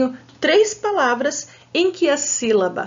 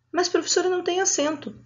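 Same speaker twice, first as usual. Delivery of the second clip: a higher, softer, lightly voiced child; pupil's objection; 6 semitones higher, 4 dB quieter, much faster than usual. Teacher voicing a pupil who doubts her, lightly. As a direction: mimic a puzzled student.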